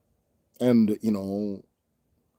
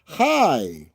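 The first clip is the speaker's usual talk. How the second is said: HR hello; 3 semitones higher, 5 dB louder than usual. Retold or imitated. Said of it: "they give you the customer service hello, the H R".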